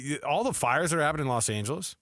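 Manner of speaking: ordinary talk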